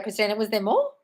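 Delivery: usual speaking voice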